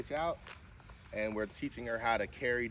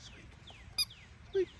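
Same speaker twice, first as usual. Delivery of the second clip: squeaky high command; slower than usual. Shown as a demonstration. Owner coaxing her dog to squeak, very high and squeaky. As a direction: squeak the word like a dog toy. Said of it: "that's what you're trying to get".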